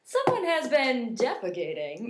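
slow, deliberate anchor read; drawn-out demo of news delivery; committed news voice. Shown as a demonstration. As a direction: really sell it like a newscaster. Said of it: "you gotta really want it".

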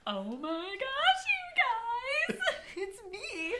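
high, slow, tearful laugh; laughing disbelief. Retold or imitated. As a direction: realise it's you, laughing through tears. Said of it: "then I'm like".

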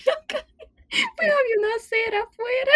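laughing close to tears; laughing tearfully. Real, not acted. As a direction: squeak it out through laughter. Laughing while retelling.

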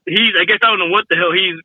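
ordinary delivery

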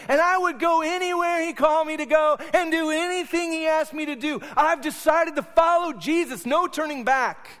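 high, loud, gushing mock devotion; hammed-up pious churchgoer. Pastor mocking churchgoers who talk big, high and loud. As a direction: ham up the show-off believer, high and loud. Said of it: "Christians who will say".

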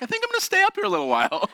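high, fast, comic little voice; meek mock reply. Son avoiding his furious dad, high quick mock-meek voice. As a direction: squeak it out quickly, playing the wary kid.

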